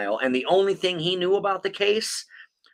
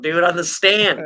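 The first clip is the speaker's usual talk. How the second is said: usual speaking voice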